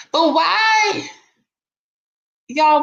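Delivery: high, loud "but why" outburst; incredulous shout. Real, not acted. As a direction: shout it in disbelief. Baffled by lawsuit detail.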